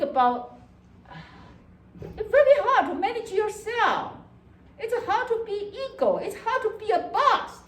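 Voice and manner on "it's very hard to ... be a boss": laughing sympathy. Real, not acted.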